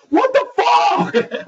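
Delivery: high, slow, loud scream; retold scream. Jumping up from his chair at the TV, screaming. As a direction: scream it out in disbelief. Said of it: "I got up out of my chair and I screamed".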